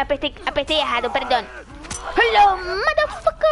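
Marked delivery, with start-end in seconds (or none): 2.2-3.5 higher and louder taunt; playful mock war cry; taunting shout. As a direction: throw it at the enemy like a catchphrase. Taunts enemy again.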